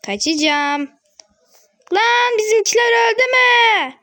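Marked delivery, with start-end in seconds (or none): 1.9-3.9 higher, loud shout of dismay; alarmed shout. Real, not acted. Gamer sees teammates dying, shouting. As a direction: yell out in dismay.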